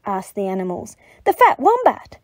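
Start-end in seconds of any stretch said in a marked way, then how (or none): 1.2-2.2 much higher, louder, greedy glee; gleeful shout. Acted. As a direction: savor the final ingredient. Dingo names the last ingredient.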